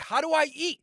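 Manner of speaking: high, pleading question; desperate plea. Retold or imitated. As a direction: cry it out high and rising.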